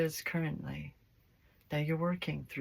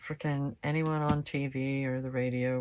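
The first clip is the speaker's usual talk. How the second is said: usual speaking voice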